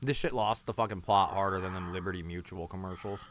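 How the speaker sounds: ordinary delivery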